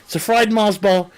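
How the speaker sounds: ordinary talk, nothing marked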